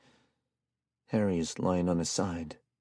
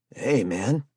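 sudden sharp call, a bit louder; startled protest; exclaiming; 6 semitones higher, 4 dB louder than usual. A narrator's reading. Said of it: "Harry exclaims".

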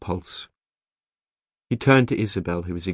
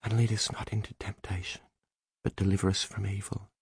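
hushed, softer murmured prayer; whispered prayer; 7 dB quieter than usual. A narrator's reading. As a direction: whisper it softly like a private prayer. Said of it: "she whispered".

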